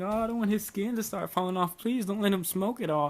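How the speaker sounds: high-pitched mock panic, imitating a mother; freaking-out mom. Mom begging dad not to let her son smoke, mock freak-out. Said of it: "freaking out".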